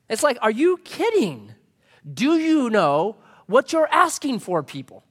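fiery sermon voice; impassioned preaching. Acted. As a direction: preach it like Paul scolding the crowd. Preacher voicing Paul, impassioned.

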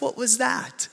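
high, hushed baffled question. Retold or imitated. Chris stunned after the woman walks off, high hushed puzzlement. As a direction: ask it half to yourself, stunned.

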